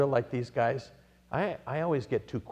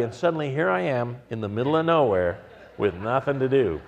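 usual speaking voice

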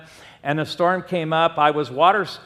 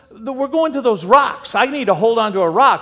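loud, higher-pitched imitation of insisting; insistent father-in-law; 5 semitones higher, 5 dB louder than usual. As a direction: argue back as the flustered father-in-law. Acts father-in-law arguing in storm.

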